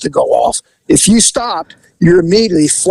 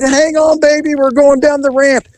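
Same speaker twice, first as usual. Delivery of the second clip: urgent reassurance; 8 semitones higher than usual. Retold or imitated. Driver rushing sick passenger in.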